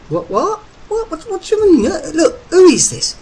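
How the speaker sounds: high, panicky, spluttering confusion; splutter, voice shooting right up; utterly bewildered. A narrator's reading. Derek to the phone operator, baffled and squeaking with confusion.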